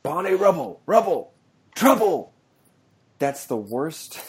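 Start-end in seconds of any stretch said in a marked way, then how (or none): 0.0-2.3 higher, hammed-up Cockney repeating each word; mock Don Cheadle's terrible Cockney, rising in pitch; exaggerated movie Cockney, playfully overdone; bad Cockney accent. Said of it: "And he goes".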